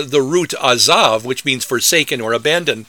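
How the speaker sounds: ordinary talk, nothing marked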